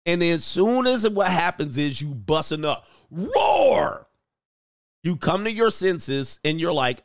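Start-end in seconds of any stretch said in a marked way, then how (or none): 3.1-4.1 loud high climax roar. Acted. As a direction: let out a big shouting roar.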